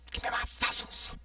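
whispered mock demand, fading out; a whispered, fading parody of the NPC's demand. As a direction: breathe it, barely audible, as if trailing off. Repeat as whisper.

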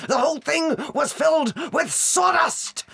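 raging at the swindle; indignant shout. Acted. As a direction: spit out the outrage. Ranting about crooked car dealer.

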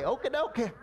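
lightly imitated comedian's meek assent; Tim Conway. Churchgoer agreeing to skip church, lightly mimicked comic voice. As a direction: give in with a small comic voice. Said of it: "We're like Tim Conway".

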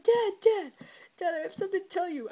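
high, loud, rushed pleading; a son bursting to confess, fast and high; eager kid. Retold or imitated. Son blurting to his dad, high and rushed. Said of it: "It's like".

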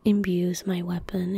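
usual speaking voice

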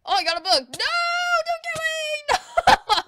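high, loud panicked shouting; panicked yelling. Real, not acted. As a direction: yell in panic as you're being attacked. Attacked in game, panicking.

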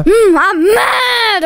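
hammed-up, drawn-out growly pout; slow, put-on mad grumble; mock anger. Player acting out the Anger morph, mock mad.

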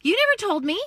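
high, loud mock shock; imitating a stunned husband; husband surprised. Husband's retold shocked reply.

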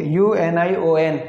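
ordinary talk, nothing marked

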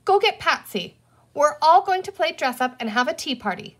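mild shout, pitched high. A narrator's reading. Girl sends her friend to fetch another, lightly shouting. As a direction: call out bossy orders to a friend. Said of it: "Penny shouted".

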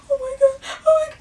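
high tearful oh my God; high crying cry of disbelief; retold crying. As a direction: wail it high and tearful, retelling. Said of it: "I was crying".

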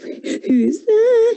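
a wolf squeaking out a fake old lady; wolf faking Grandma. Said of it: "He pretended to be Grandma".

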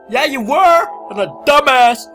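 loud, high self-scolding; loud exclamation. Real, not acted. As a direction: shout it at yourself. Shouting agreement at game.

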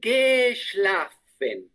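very slow "geschlafen" with long "sh"; clear SCH. Shown as a demonstration. Teacher corrects student's "geschlafen", slow with strong "sh". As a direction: split "geschlafen" into ge, shhla, fen. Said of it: "pronunciar la S C H un poquito más".

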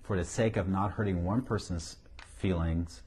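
usual speaking voice

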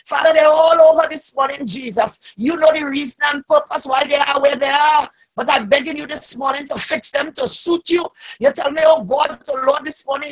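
ordinary talk

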